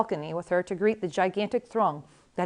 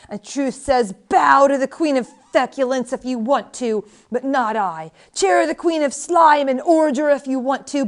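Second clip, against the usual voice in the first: raised, ringing voice of outrage; unquieted tirade; 4 semitones higher, 6 dB louder than usual. A narrator's reading. Old woman will not be quieted, rails at the queen, yelling. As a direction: rail on loudly, hammering each insult. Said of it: "would not be quieted".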